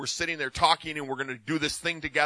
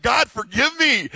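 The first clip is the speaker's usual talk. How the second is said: retold plea; 9 semitones higher, 5 dB louder, slower than usual. Retold prayer of repentance.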